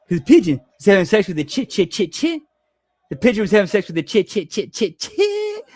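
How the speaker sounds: fast stuttering punchline with a laugh; stuttering Jimmy impression. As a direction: stammer chit, chit, chit before chicken. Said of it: "I can do a pretty damn good Jimmy".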